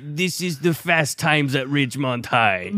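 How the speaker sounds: feed him the line in a drawn-out impression; Forest Whitaker. Co-host prompting the impressionist, slow mock Forest Whitaker voice. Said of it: "Now, now say".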